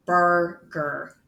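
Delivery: "burger" split into two separate syllables, bur, ger. Shown as a demonstration. Teacher showing the example below, "burger" broken into syllables. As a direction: say "burger" slowly in two pieces.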